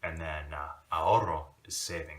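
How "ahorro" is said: "ahorro" loud, with a trilled rr; rolled Spanish R. Shown as a demonstration. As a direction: roll the rr hard in "ahorro". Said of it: "That's the other example".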